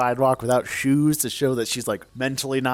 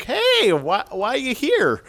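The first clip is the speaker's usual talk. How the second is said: high-pitched put-on greeting; acted-out surprised hello; mock concerned greeting; 9 semitones higher than usual. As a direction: open very high, then ease down into the question. Acting out greeting the distraught mom.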